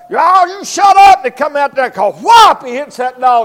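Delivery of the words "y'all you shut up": higher, louder shout of shut up; angry owner shout. Retold or imitated. Owner shouts at the guard dog to hush, louder now.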